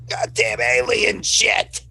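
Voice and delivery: high, drawn-out cry of exasperation; exasperated character outburst. Acted. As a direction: curse slowly in a high character voice. Player voices Ethel's outburst in play.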